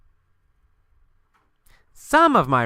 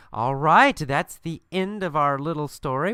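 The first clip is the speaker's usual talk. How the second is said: ordinary delivery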